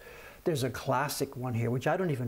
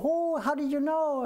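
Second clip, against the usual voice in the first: squeaky, much higher, slow surprised gasp of a question; high delighted surprise; 14 semitones higher, 3 dB louder than usual. Retold or imitated. Shop owner voicing tourist ladies amazed at his guess, high and slow. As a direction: imitate a cheerful lady's delight. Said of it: "And they're all".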